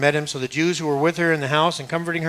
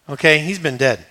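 ordinary delivery

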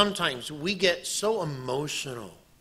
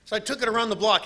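usual speaking voice

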